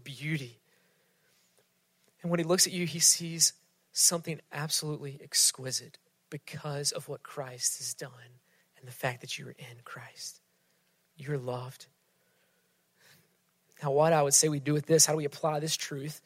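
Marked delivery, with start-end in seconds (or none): 3.9-11.9 fading to a near whisper; hushed. Real, not acted. Preacher closing the point, softly and gently. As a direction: draw them in, barely audible. Intimate sermon climax.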